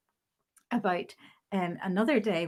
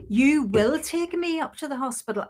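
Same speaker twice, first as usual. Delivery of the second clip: higher and louder, heavy on WILL; insistent demand; 5 semitones higher, 6 dB louder than usual. Retold or imitated. Nurse demanding a lift to the hospital, firmly insistent. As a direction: insist as if rapping a desk. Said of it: "tapping his desk".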